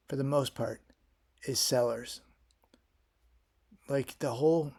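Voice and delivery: ordinary talk, nothing marked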